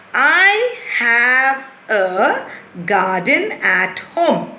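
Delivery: slow, carefully spaced reading; slow model sentence. Shown as a demonstration. Teacher slowly models the corrected sentence for the children. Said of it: "now you understood".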